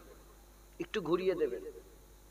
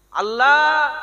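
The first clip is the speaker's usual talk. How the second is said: loud high cry; 9 semitones higher, 15 dB louder, much slower than usual. Real, not acted. Preacher crying out to the crowd, loud and high. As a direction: call out Allah high and loud. Preacher exclaims God's name.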